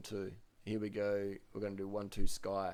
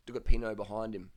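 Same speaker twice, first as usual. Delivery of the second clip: ordinary talk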